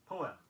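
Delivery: Poem said in two clear syllables, po-em. Shown as a demonstration. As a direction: give Poem both its syllables, po-em. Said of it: "a two-syllabled word".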